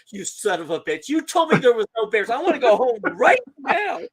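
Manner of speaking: high, loud imitated tirade building to the end; hysterical outrage. Joe blaming dad for the bear, hysterical outrage. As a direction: rant like a scared man who was lied to.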